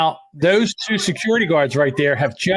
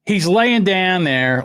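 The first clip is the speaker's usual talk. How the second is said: normal talking voice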